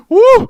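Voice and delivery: loud, very high "woo"; excited whoop. Real, not acted. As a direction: let out a sudden piercing whoop.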